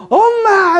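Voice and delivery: very high wail for mommy; mock crying like a child. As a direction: bawl out like a drunk overgrown baby. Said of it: "애처럼 울면서". (애처럼 울부짖는 시늉)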